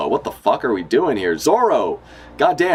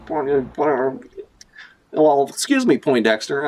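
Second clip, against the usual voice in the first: sassy brush-off; 5 semitones higher than usual. Acted. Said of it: "And she's like".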